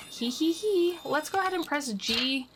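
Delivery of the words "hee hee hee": higher, mimicking pixie titter; mock giggle. Witch mimicking the pixie's laughter, higher titter. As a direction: copy the sprite's giggle.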